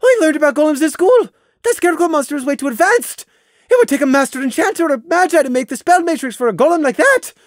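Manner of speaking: high, fast frantic babble; acted hysterical rush of words; panicked. A narrator's reading. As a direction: argue in a rising panic, barely stopping. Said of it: "sounding panicked".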